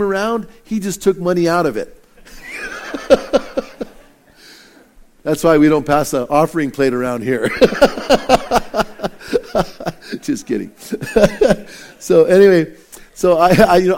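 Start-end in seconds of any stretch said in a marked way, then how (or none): 2.3-3.8 airy unvoiced chuckle at his own punchline; breathy laugh. Real, not acted. Pastor laughing at his own Mark Twain story, airy chuckle. 7.5-10.1 short chuckle at his own quip; laugh. Real, not acted. Pastor chuckling at his offering plate quip, light laugh. 10.9-11.6 quick laugh after kidding; brief real chuckle. Real, not acted. Pastor laughing after saying just kidding, quick chuckle.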